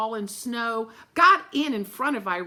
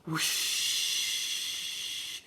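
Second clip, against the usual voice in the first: breathy whooshing wind noise; soft airy whoosh; 6 semitones lower, 5 dB quieter than usual. A narrator's reading. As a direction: blow it out like a gust. Narrator voices the wind.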